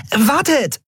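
very high little-creature call. A narrator's reading. Small Nelen chasing her friends, calling out. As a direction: call after them in a high voice. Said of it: "rief Nelen".

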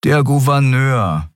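slow, patient answer; patient explaining. A narrator's reading. Riur patiently tells Kai who it is, slow and calm. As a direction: spell it out gently for the newcomer. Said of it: "erklärte Riur geduldig".

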